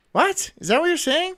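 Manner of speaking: high-pitched, disbelieving questions; incredulous. Real, not acted. Streamer questioning viewers who say he sounds like a kid, incredulous. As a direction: voice leaping up in disbelief.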